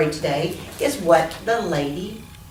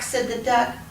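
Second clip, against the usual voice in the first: plain reading voice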